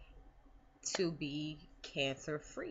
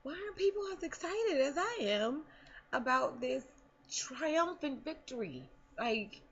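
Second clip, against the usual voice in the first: incredulous; 11 semitones higher than usual. Retold or imitated. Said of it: "And I'm like".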